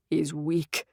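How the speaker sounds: low, soft, hollow remark; low quiet voice. A narrator's reading. Dream figure speaks.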